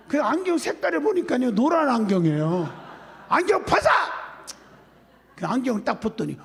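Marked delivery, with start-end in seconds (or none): 3.3-3.9 sudden high, loud shout; angry command. Retold or imitated. Pastor ordering the woman to remove glasses, angry shout. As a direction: re-enact an exasperated, explosive order.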